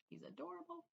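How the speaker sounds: high, soft cooing over the puppy. Real, not acted. Gushing about the family dog, high soft coo.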